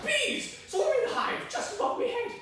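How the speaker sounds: high, urgent cry of alarm. Acted. Storyteller finds a hive above his head, alarmed. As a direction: blurt the danger out high-pitched. Discovers bees overhead.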